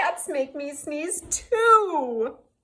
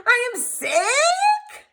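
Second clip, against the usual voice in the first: surprised; 9 semitones higher, 6 dB louder, slower than usual. Acted. Gerald thrilled to be sick.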